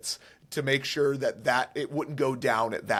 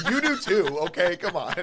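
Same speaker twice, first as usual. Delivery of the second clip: talking through laughter; giggling while speaking; laughing; 3 semitones higher, faster than usual. Real, not acted. Laughing at the request.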